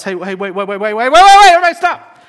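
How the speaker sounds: building from talk to a high, loud shout; calling out. Acted. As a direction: grab everyone's attention, urgent. Crowd realizing Jesus stopped.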